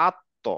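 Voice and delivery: আত্ম with lips rounded, heavy t; rounded Arabic ta. Shown as a demonstration. Teacher demonstrating to class, rounded আত্ম. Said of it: "আত্ম একটু গোল হয়ে যাবে".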